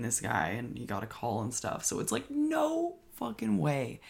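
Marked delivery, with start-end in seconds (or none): 1.8-4.0 disbelief. Real, not acted. Disbelief at cop shooting.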